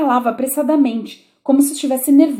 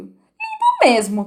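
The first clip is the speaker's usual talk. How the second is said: Alice agreeing; slower than usual. A narrator's reading. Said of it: "concordou Alice".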